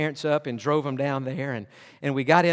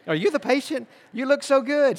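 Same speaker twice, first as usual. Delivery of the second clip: much higher, amazed question; surprised receptionist; 10 semitones higher, 3 dB louder than usual. Retold or imitated. Receptionist amazed the patient looks so well, high and surprised. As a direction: gush in disbelief.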